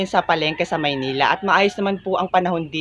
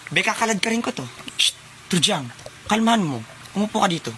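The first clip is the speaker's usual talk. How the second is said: usual speaking voice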